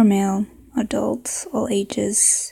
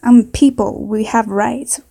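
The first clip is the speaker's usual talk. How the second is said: put-on indignant Southern accent; 4 dB louder than usual. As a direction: protest loudly in a Southern accent. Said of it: "maybe a southern accent".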